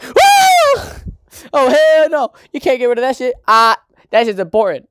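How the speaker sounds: high whoop into loud, hammed-up objection; shrieky mock alarm, then insistent protest; panicked protest. Boy seeing the fish charm taken off, panicked.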